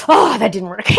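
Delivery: loud burst into laughter; real amused outburst; laughing at failure. Real, not acted. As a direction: exclaim loudly, then crack up. Failed attempt, laughs loudly after it.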